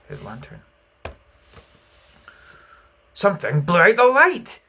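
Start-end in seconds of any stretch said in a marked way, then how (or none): 3.1-4.6 shaken. A narrator's reading. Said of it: "he said a little".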